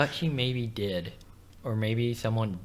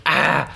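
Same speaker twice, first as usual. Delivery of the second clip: loud, higher mock yell; imitated startled yelp; 4 semitones higher, 10 dB louder than usual. Retelling the Pope grabbed by a lady, mock yelp. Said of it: "and he goes".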